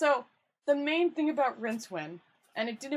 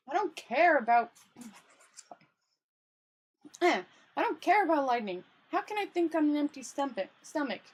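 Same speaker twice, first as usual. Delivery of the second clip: frustrated complaint; 5 semitones higher, 4 dB louder than usual. Acted. Hungry Rincewind complains loudly.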